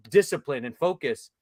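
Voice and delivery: ordinary talk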